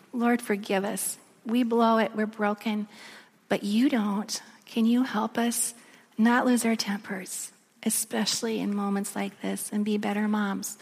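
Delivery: hushed plea to God; simple prayer. Retold or imitated. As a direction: pray it humbly and quietly, pausing between phrases. Recites her simple prayer.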